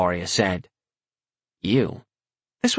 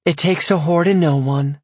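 sweetly; 6 semitones higher than usual. A narrator's reading. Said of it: "Eloria said sweetly".

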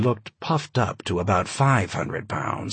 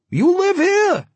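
much higher, loud insistent voice; high-pitched giant; 6 dB louder than usual. A narrator's reading. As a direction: demand it in a squeaky big voice. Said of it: "he had an oddly high-pitched voice".

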